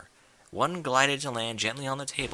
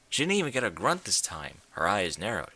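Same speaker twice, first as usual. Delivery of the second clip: usual speaking voice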